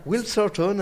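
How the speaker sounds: Will Thornton with a hint of an Australian accent. Host puts on his Australian accent on purpose. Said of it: "Mon accent australien".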